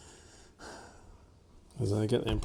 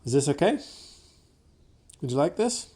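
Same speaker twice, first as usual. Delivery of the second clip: high, louder sing-song question to the dog; gentle asking; 5 semitones higher, 7 dB louder than usual. Real, not acted. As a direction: ask the dog kindly and brightly.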